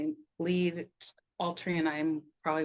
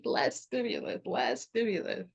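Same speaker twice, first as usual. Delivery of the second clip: quoting herself; 5 semitones higher than usual. Retold or imitated. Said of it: "I'm like".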